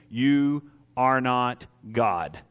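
very slow, each word weighted; slow emphatic. Acted. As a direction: drop it slowly and heavily. Repeated sermon punch line.

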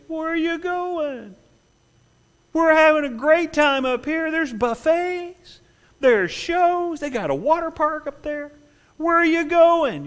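high, mocking cruise-goer voice; mocking partygoers. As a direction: taunt them from the party deck. World on cruise ship mocking.

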